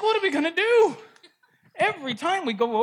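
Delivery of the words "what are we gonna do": panicked Druids. Acted.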